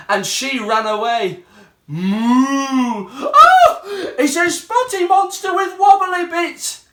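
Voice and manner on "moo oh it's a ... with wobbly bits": a moo, then a much higher yelp of fright; Little Mug shrieks at the cow; frightened cry. Acted. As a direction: squeal in terror at the spotty monster. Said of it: "cried Little Mug".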